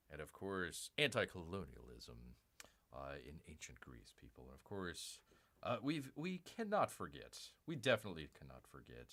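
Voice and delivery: lightly voiced radio presenter, hushed and earnest; NPR voice. Hosting a mock ancient Greek fashion show, in a soft NPR voice. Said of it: "Gotta get out of my N P R voice here".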